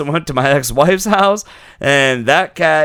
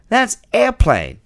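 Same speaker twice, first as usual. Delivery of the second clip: imitating child; 10 semitones higher, slower than usual. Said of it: "And he goes".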